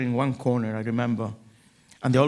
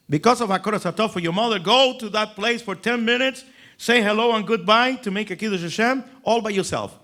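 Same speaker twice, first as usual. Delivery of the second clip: higher, louder quoted rabbi; quick higher rabbi advice; rav's voice; 7 semitones higher, 3 dB louder than usual. Retold or imitated.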